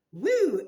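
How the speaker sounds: loud, high woo of excitement; sudden loud excited woo; loud whoop. Real, not acted. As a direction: let out a whoop. Reacting to cards.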